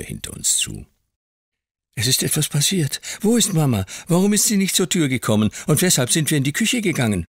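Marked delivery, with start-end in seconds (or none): 2.0-7.2 put-on high, quick, anxious boy's voice; a scared little boy pleading for answers; child voice, urgent and worried; frightened child. Narrator voices the boy Davy.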